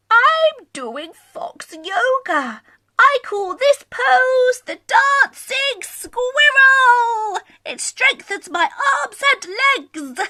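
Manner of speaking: a gentle, pleased little fox showing off a pose; calm Scaredy Fox. Acted. Voices Scaredy Fox in story.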